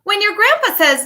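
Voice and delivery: lively, sing-song picture book reading; reading to children. Acted. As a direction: read it like storytime for little kids. Teacher reads picture book aloud.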